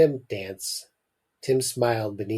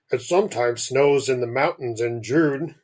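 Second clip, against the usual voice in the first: the father. Acted. Father answers Mum about seeing snow, put-on dad voice. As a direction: voice the father as a character. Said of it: "said the father".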